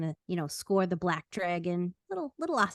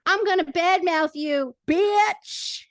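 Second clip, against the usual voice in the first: higher, louder playful trash talk; mock threat; 8 semitones higher, 8 dB louder, slower than usual. Shown as a demonstration. Crude example shouted at cohost as a joke. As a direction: bark it at your cohost in jest.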